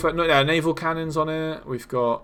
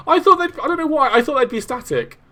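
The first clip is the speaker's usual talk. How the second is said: higher, louder, still astonished; excited high voice; 10 semitones higher, 5 dB louder than usual. Real, not acted.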